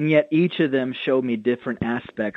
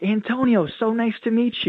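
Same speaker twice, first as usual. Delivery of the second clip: much higher, bright eager hello; excited greeting; 9 semitones higher than usual. Retold or imitated. Pastor retelling how he greeted an eager churchgoer, excited and high. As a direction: greet him like you're thrilled to meet him.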